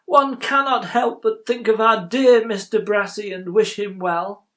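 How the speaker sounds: put-on high, loud old gentleman's voice; earnest old employer. Old banker wishing Mister Brassey well, loud and earnest.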